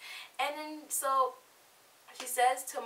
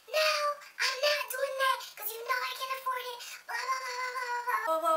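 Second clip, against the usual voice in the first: very high mocking roommate voice; whine-yell it in a squeaky put-on pitch; imitated yelling. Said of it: "stop yelling at me".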